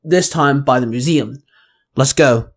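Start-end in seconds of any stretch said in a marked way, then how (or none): none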